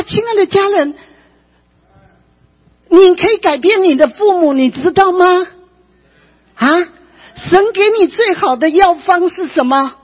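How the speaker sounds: high-pitched, forceful exhortation; impassioned preaching. Real, not acted. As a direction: drive the lesson home to the congregation. (高声激昂地劝勉会众)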